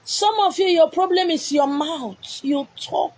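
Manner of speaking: high, slow rebuke; sharp rebuking preach; scolding preacher. Acted. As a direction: scold them from the pulpit.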